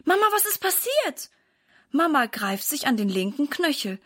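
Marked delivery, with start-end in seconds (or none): 0.1-1.3 put-on high, alarmed child voice; a frightened daughter asking; girl, much higher, alarmed; startled girl. A narrator's reading. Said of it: "Erschrocken dreht sich Sarah um".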